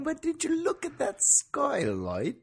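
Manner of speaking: high, slow, hammed-up Aussie voice; Australian girlfriend. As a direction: gush in a high mock Aussie voice. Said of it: "So I'm playing your girlfriend here".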